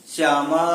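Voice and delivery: श्यामा with the final आ held very long; calling, drawn-out vowel. Shown as a demonstration. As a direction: call out श्यामा to someone far away, stretching the last आ. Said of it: "दूर खड़े व्यक्ति को हम पुकारते हैं".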